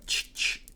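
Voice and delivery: sharp voiceless ch-ch of a shotgun racking; imitated shotgun pump. Storyteller acting out the robber pumping the shotgun, imitated gun noise. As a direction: make a pump-action noise with your mouth.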